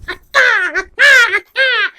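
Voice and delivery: very high, loud squawks; bird screech. Acted. Student being a bird in class, loud screeching.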